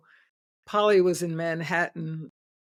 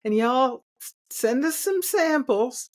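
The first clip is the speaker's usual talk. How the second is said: put-on Southern accent; 6 semitones higher than usual. Sales rep asking the founders for samples, Southern accent. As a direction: imitate a Southern salesman making a request. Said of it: "in a very thick Southern accent".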